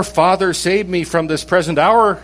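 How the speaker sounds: outburst of prayer. Acted. Jesus praying to the Father as trouble nears, an outburst of prayer. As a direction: burst out praying, pitched high. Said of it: "He bursts out in this prayer".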